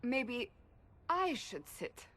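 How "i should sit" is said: "I" pitched high and punched, then falling; stress on I. Acted. Nervous friend decides to sit instead, stress on "I". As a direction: lean hard on "I", let the rest drop. Turns own suggestion onto herself.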